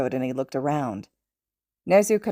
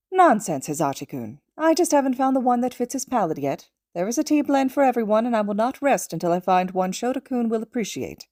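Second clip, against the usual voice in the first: higher, confident little mentor voice; Nezu, prim and certain; 6 semitones higher than usual. Acted. Nezu insisting to his student about tea, higher and confident. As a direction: lecture him with cheerful certainty.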